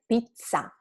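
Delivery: pizza with a firm, lengthened double Z; long double consonant. Shown as a demonstration. Teacher repeats correct pizza for students, long double consonant. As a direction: hold the zz in pizza. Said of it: "make the sound a little bit longer".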